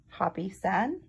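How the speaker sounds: usual speaking voice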